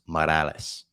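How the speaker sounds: Morales said the Spanish way, rolled and crisp. Shown as a demonstration. Podcast host flaunting the name Morales, Spanish pronunciation. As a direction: give Morales a light Spanish ring. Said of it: "I did the little thing on Morales there".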